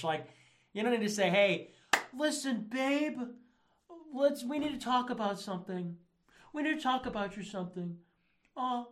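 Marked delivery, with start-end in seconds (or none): 1.3-8.0 high-pitched mock boyfriend voice; placating boyfriend. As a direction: parody a timid talk with a girlfriend. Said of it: "excuse it to your girlfriend".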